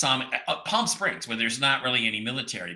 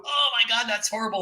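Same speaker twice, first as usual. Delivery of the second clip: high-pitched hammed-up moan; mock pain; 9 semitones higher than usual. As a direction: act out joke agony, way up high. Joke patient howls in pain.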